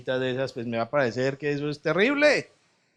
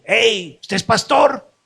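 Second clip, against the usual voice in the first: high, loud cartoon bird asking; lightly voiced bird, hammed-up; mock pelican voice; 9 semitones higher, 12 dB louder, slower than usual. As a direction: play a cheeky pelican calling out.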